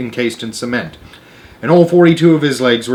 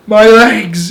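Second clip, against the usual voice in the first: high, loud cry of dismay; 9 semitones higher, 7 dB louder than usual. A narrator's reading. Centipede crying out about his painted legs. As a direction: wail at the sticky paint. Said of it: "he cried".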